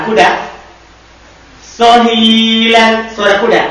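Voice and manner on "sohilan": slow dictation. Shown as a demonstration. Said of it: "teacher dictating Arabic form".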